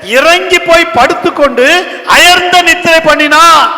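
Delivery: high, loud sermon delivery; full-force preaching. Acted. Pastor telling of Jonah asleep below deck, full-force preaching.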